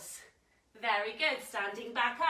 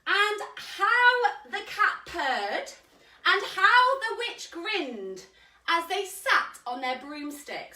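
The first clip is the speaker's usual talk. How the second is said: story reading; 5 semitones higher, 4 dB louder, slower than usual. A narrator's reading. Reading picture book aloud.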